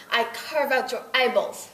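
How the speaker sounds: put-on loud, slow menacing Russian accent. Russian accent, loud threat to the interviewer. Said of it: "with a Russian accent".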